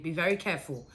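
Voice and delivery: plain reading voice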